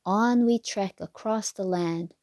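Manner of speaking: ordinary delivery, nothing marked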